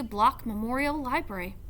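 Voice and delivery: ordinary delivery, nothing marked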